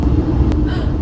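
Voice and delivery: sharp unvoiced gasp of fright; loud breathy gasp; startled gasp. Real, not acted. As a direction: gasp at a sudden scare. Startled by GoPro.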